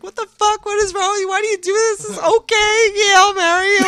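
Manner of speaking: high-pitched, hammed-up tearful yelling; imitated hysterical crying. Fiancée putting the ring on while yelling, imitated hysterical crying. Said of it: "crying hysterically".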